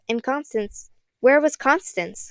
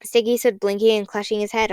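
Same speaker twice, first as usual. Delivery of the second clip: ordinary delivery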